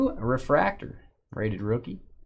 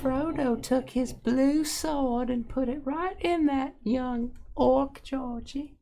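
high-pitched mock Georgia storyteller voice; squeaky Georgia drawl reading a story; 13 semitones higher, 4 dB quieter, slower than usual. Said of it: "in your Georgia voice".

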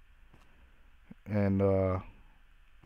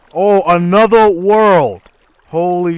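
loud, slow, stretched-out wonder; real awe yelled out; amazed shout; 6 semitones higher, 11 dB louder, slower than usual. Real, not acted. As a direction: shout it in disbelief at the discovery. Discovers hidden underground world.

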